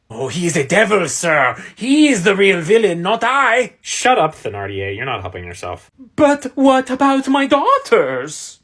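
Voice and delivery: ordinary talk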